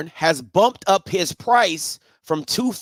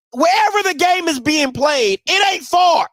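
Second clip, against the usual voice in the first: raised, heated rant voice; 5 semitones higher than usual. Real, not acted. Heated rant about coach.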